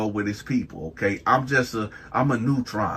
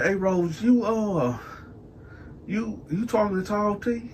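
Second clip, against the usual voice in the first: nosy question; 10 semitones higher, 4 dB quieter than usual. Retold or imitated. Teller asks Rose about rival.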